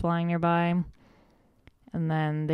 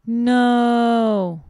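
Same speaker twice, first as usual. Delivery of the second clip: a high, loud cry of "No!"; horrified shout; 7 semitones higher, 9 dB louder than usual. Retold or imitated. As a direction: scream it as he falls off. Kids see Scrooge falling.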